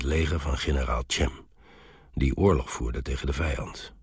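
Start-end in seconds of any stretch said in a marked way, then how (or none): none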